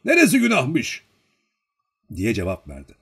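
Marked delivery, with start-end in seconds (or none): 0.1-1.0 firm retort, high and loud; determined voice. A narrator's reading. Old man answering Olenin about sin, firmly indignant. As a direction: throw the question back with conviction. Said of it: "İhtiyar kararlı bir sesle".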